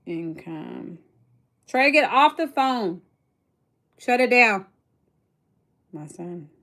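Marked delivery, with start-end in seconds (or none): 1.7-4.7 raised voice across the room; loud mom telling her son to get off the phone; calling out to son. Real, not acted.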